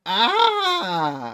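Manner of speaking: loud, high drawn-out "aah"; imitated cry. Fan acting out the regenerating troll scene, loud cry. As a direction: wail it out in mock horror. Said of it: "it's just like".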